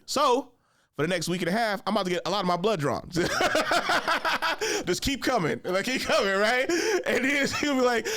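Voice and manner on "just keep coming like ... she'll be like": laughing through the plan; real amused laughter. Real, not acted. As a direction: giggle as you say it.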